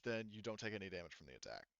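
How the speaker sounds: usual speaking voice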